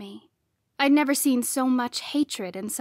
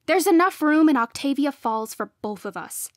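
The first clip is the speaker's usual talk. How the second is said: loud, higher, forceful; insistent raised voice; heated insistence; 4 semitones higher, 6 dB louder than usual. A narrator's reading. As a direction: argue your right to stay. Arguing back at rival.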